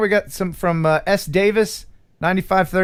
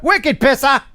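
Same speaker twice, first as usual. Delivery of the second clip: put-on high, punched Boston accent; attempted Boston accent; 7 semitones higher, 3 dB louder than usual. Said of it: "I can't do a Boston accent".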